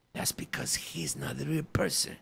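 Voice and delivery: usual speaking voice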